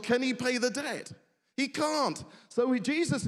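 normal talking voice

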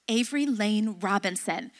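low, quiet, stern parent; stern mom, voice dropped low; serious full-name warning. Retold or imitated. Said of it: "Serious when you get the whole name".

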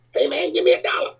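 high squeaky beggar's plea; begging midget voice. Retold or imitated. To the singer after a gig, high squeaky begging. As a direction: pitch it way up like a tiny street hustler.

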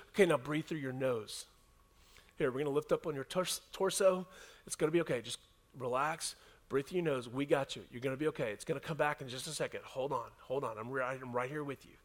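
soft coaching calm. Retold or imitated. Coaches to a winded player on the ground, soft and calming. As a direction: talk him gently through it, low and quiet.

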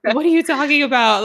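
loud, confused disbelief; baffled reaction. Retold or imitated. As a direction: react like you can't understand a word. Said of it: "I'm like".

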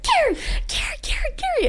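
high, urgent whispered calling; frantic whisper. Retold or imitated. Wife retelling whispering her husband's name in fear. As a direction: hiss his name in a panic. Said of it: "and I'm like".

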